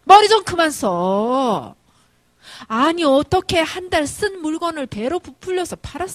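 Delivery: loud, drawn-out outraged shout; scolding yell. Retold or imitated. Mother scolding her eldest for overcharging his brother, scolding yell. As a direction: tell him off at full voice, stretching words. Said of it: "이제 소리를 지르죠".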